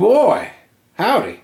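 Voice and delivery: put-on high, loud old-timer yelp; old prospector. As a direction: whoop it like a grizzled miner. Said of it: "what in tarnation".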